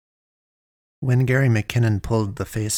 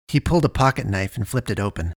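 usual speaking voice

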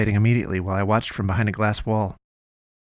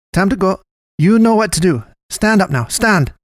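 high, raised character voice giving orders; commanding little medic; 8 semitones higher, 5 dB louder than usual. A narrator's reading. Miguel orders his patient onto their feet, commanding. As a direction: order it pitched up and forceful.

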